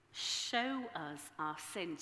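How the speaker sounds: ordinary talk, nothing marked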